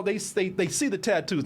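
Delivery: normal talking voice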